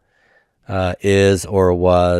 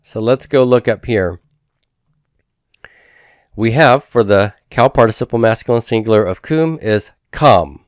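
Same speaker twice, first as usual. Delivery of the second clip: ordinary talk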